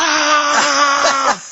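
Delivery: sudden high yell of shock; scream. Retold or imitated. Seeing his old foes in the dream, a high shocked scream. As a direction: scream out in terror. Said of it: "I screamed so loud".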